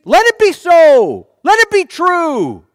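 slow, high, very loud cry; booming pulpit shout; shouted affirmation. Acted. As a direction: proclaim it across the valley, drawn out. Preacher acts out crowd's answer.

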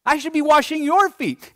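high, loud, appalled disbelief; scandalized, building from a stunned question to a high shout; incredulous outrage. Acted. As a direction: act out Peter's shocked protest, voice shooting up. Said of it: "it falls really hard on thou and mine".

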